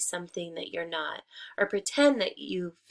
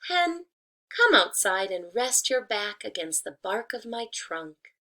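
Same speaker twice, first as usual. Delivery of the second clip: coaxing tree; 4 dB louder than usual. Acted. Disguised wolf luring the hen outside, sweetly coaxing. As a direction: lure her out sweetly. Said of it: "said the tree".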